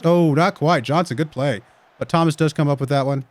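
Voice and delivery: lively match commentary; play-by-play. Acted. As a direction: call the game as it happens.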